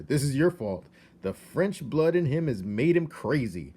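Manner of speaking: an angry husband blaming his French wife; blaming outburst. A narrator's reading. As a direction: jab the blame at her.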